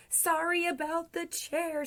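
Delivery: light, high call from afar; Santa calling back. A narrator's reading. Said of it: "Santa cried".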